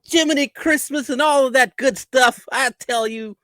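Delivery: aw-shucks goody-goody; fake wholesome. Hunter Biden parody voice.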